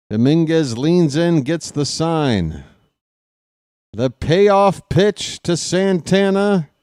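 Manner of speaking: baseball announcer building tension; play-by-play call. Acted. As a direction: call each pitch like a radio broadcaster, growing keyed up. Announcer calling a ball game.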